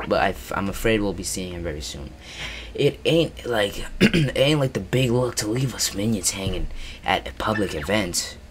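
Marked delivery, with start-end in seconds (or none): none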